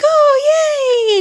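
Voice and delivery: high, squealed imitation of delight; hammed-up gleeful squeal, held long; very excited. Host imitating an aunt giving a big hug, very excited squeal. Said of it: "Aunt Rosa gets very excited".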